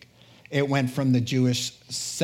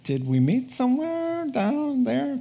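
puzzled Nathaniel; 10 semitones higher than usual. Acted. Nathaniel puzzled, voiced high.

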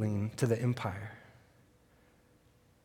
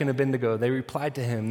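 normal talking voice

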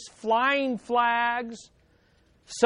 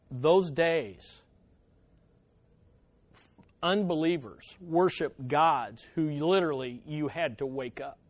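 ordinary delivery

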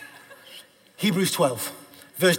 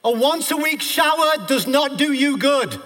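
high, loud declamation in driving bursts; full-force preaching; 10 semitones higher, 4 dB louder than usual. Real, not acted. Preacher declaring who God is to the congregation, full-force preaching. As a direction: proclaim each title of God at full pitch.

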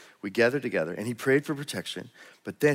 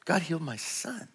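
raised, slowed, awed declaration; moved, exultant; 7 semitones higher than usual. Retold or imitated. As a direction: proclaim it like the mother overcome with joy. Reading a mother's joyful testimony.

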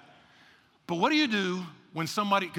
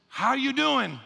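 acted cheery greeting; 5 semitones higher, 3 dB louder, slower than usual. Acts out somebody greeting the listeners.

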